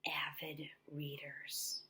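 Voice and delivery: whispered, slow, secret-sounding avid readers; whispered reveal. Shown as a demonstration. Teacher whispering the new unit name to young readers. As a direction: whisper it like a surprise. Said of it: "it's called".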